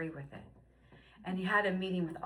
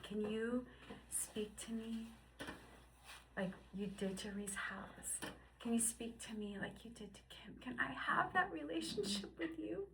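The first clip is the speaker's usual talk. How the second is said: hushed prayer; 2 semitones higher, 10 dB quieter than usual. Retold or imitated. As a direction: whisper a prayer. Whispered prayer retold.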